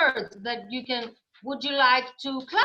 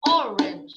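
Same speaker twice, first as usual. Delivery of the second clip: ORANGE split into two even beats, loud; two-beat demo; 3 semitones lower, 7 dB louder than usual. Shown as a demonstration. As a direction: clap out or-ange as two syllables. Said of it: "Orange has two syllables".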